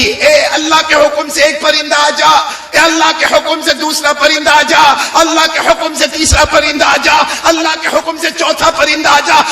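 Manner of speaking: high, ringing summons; calling out. Retold or imitated. Ibrahim summons four birds by Allah's command, calling out. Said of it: "آواز دے دی".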